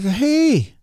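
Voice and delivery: put-on high cooing baby talk to a small bird; high sing-song coo. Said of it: "I like".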